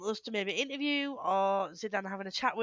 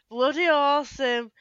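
slow, louder Yorkshire drawl; Yorkshire accent; 5 semitones higher, 8 dB louder than usual. Pretend. Echoing her co-host in a slow, loud Yorkshire voice. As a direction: stretch it out in broad Yorkshire. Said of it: "great Yorkshire accent, Duck".

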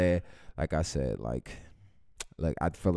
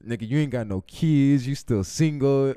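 mocking friend; 6 semitones higher, 7 dB louder than usual. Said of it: "When you say".

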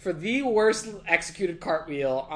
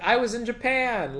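higher-pitched mimicked quote; lightly voiced breezy excuse; 6 semitones higher than usual. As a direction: toss off an excuse as her, lightly. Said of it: "And she's like".

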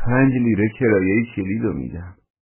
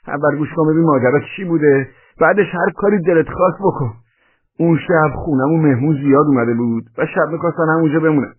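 high, raised-voice self-defence; agitated high, loud explaining; pleading under attack; 8 semitones higher, 5 dB louder than usual. A narrator's reading. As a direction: protest and beg for mercy. Beaten man pleads and explains.

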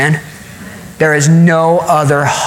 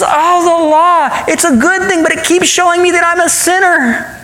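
high-pitched exasperated lament; frustrated; 10 semitones higher than usual. Acted. Preacher voices Paul lamenting the law, frustrated. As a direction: groan over it high, stretching the opening. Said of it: "And he's just frustrated".

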